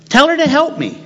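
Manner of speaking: high, whining tell her to help me; whiny demand. Acted. Martha whining to Jesus about her sister, high and whiny. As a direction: pitch it way up like a nagging sister. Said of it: "repeated with much higher pitch".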